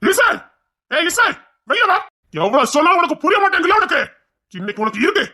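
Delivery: put-on furious shout at a naughty girl; angry yelling. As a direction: yell at her to come outside.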